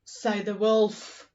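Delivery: normal talking voice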